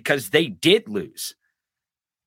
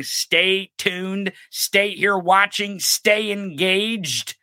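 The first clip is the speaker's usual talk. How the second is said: slowing emphasis; 4 semitones higher, much slower than usual. Real, not acted. Slowing pleas to audience.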